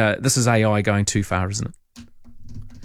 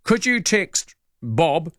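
slow and clear; 2 semitones higher, slower than usual. Retold or imitated. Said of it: "read the message out nice and clearly".

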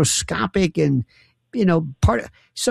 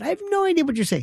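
fast, much higher amused cry; laughing disbelief; 12 semitones higher, 3 dB quieter, much faster than usual. Real, not acted. Teasing the caller, high fast laughing.

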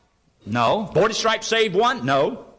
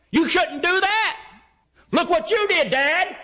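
son talking back; 9 semitones higher than usual. Retold or imitated.

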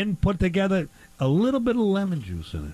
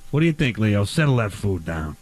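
put-on grill-master voice; 9 semitones lower than usual. Host putting on a grill-master voice.

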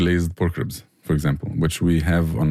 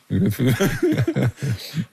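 high, drawn-out chuckling; real laughter; 7 semitones higher than usual. Real, not acted. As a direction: laugh at your own joke. Laughing at own fish joke.

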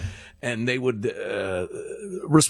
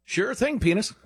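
high, obliging lilt hiding an insult; cheerfully deferential; 10 semitones higher than usual. Retold or imitated. Chef insulting a busser in a cheerfully obliging tone. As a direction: imitate a chef's polite yes-sir tone. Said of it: "say it with the intonation of".

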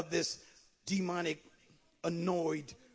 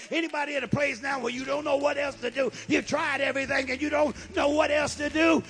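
preaching to a climax; 6 semitones higher, 7 dB louder than usual. Acted. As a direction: drive up to a cried-out plea. Builds sermon to a climax.

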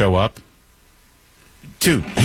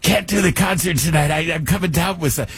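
higher, hammed-up rocker begging off the gig; 7 semitones higher than usual. Host imitating the singer calling in sick, hammed-up apology. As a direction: put on a mock apologetic old rock star. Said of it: "He called in sick".

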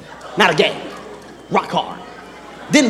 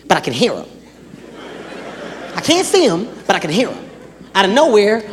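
ordinary delivery

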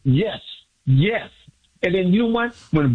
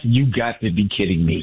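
retold disbelief; 9 semitones lower than usual. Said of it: "I said".

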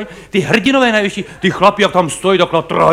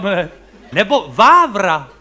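usual speaking voice

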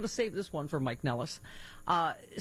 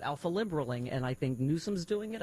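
ordinary talk, nothing marked

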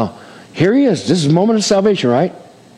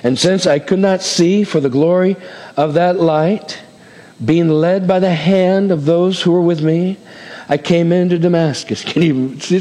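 ordinary talk

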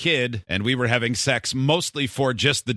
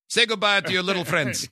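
put-on Scarface accent; 4 semitones higher than usual. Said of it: "You think she did the accent?".

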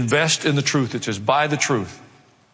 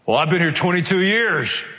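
mock indignant, higher and rising protest; indignant entitlement; 4 semitones higher, 3 dB louder than usual. Church member claiming privilege by tenure, mock indignant. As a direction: pull rank like an affronted old-timer.

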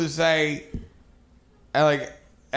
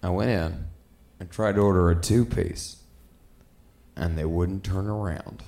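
low, halting, resigned voice; subdued mimicry of a timid friend; passive friend; 9 semitones lower, 3 dB quieter than usual. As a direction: recount it meekly in little low pieces. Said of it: "friend who's a real passive dude".

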